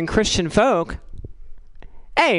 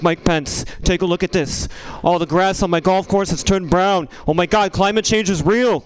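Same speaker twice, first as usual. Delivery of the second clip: Trump impression. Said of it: "He's like".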